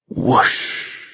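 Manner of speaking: voiceless rushing whoosh; breathy whoosh. Acted. Narrator voicing the wind rush, voiceless whoosh. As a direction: blow it out like wind.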